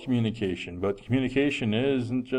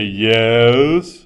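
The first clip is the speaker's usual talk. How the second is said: sarcastic yes; 8 dB louder than usual. Retold or imitated. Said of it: "that little sarcastic twist".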